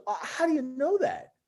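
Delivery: high, imitated puzzled query; incredulous questioning. Player questioning his certainty of a seat, incredulous.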